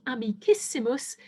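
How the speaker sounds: amicissimus with the stress on "ciss"; stress on antepenultimate syllable. Shown as a demonstration. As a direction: punch the "ciss" in amicissimus, leaving "si" unstressed. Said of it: "we put the stress on the preceding syllable".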